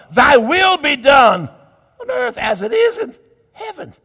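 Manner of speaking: loud, rising preacher cadence that climbs high; fiery preaching. Acted. Preacher proclaiming to his congregation at full force.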